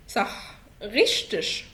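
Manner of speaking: غشتش stretched very slowly, each sound clear; very slow demo. Shown as a demonstration. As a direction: draw out غشتش slowly as ghesht-sh. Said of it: "اقرأ معايا". (نطق غشتش ببطء شديد)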